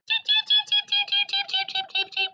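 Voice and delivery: very high, tiny chirps; baby chicks cheeping. Acted. Baby birds hatching on Gerald's head, high cheeps. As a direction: squeak like newborn chicks.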